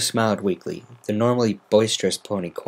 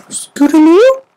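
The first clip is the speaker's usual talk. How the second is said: much higher, loud rising call; Rarity calling gently into a cave; Rarity finding the lost filly; calling Scootaloo; 15 dB louder, slower than usual. Acted. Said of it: "smiling warmly".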